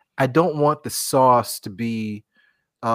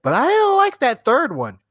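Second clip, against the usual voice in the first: picky taster; 7 semitones higher, 5 dB louder than usual. Retold or imitated. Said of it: "it'd be like".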